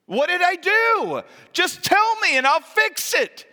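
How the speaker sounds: high, loud, pleading cry; desperate exclamation. Acted. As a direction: beg to know what you did, voice up high. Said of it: "David exclaimed".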